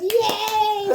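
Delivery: high, loud "Yay!"; mock cheering crowd. People in line cheer as the couple finally leaves, high and loud.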